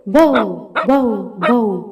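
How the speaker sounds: short, separate bow-wow barks; dog imitation. Shown as a demonstration. Teacher shows how the dog sounds, barking. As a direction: bark like a dog. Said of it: "കൈഫ നബഹ അൽ കൽബ്?".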